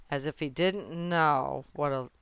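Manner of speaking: usual speaking voice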